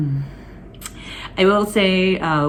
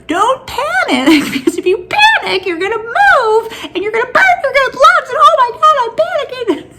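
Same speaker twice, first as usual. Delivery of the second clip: much higher and louder, frantic; panicked self-talk; 9 dB louder than usual. Retold or imitated. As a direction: talk yourself down while panicking. Telling herself not to panic.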